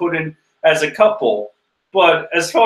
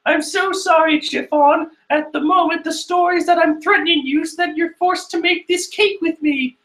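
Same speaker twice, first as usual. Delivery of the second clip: put-on very high squeaky girly voice; silly Puddin voice; 13 semitones higher than usual. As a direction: apologize in a goofy falsetto. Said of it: "she's saying in a flashback".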